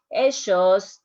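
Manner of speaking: "ellos" said "eshos" with a sh sound; double L as sh, Argentine. Shown as a demonstration. Teacher showing students the Argentine "ellos" with sh. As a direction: Argentine style, ll in "ellos" becomes sh. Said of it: "the pronunciation of the double L".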